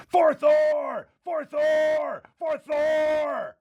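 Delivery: high, drawn-out yell at reduced volume, fading; yelling back. Acted. Blacksmith caught up in the moment, yelling back to Korgoth. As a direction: answer the war cry like a distant echo. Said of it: "he starts yelling back at you".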